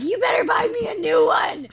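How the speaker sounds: high, loud-ish indignant order; comic outraged ultimatum; retold angry demand. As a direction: demand it in a worked-up squeal. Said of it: "I got so mad".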